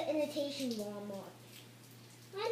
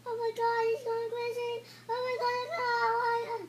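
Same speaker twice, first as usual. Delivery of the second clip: panicked; 9 semitones higher, faster than usual. Acted. Panic at crazed character.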